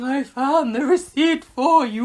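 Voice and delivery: high, slow, drawn-out imitation; hammed-up soap heroine, stretched and high; mock plaintive call. Grace in the hospital bed calling out, mock plaintive.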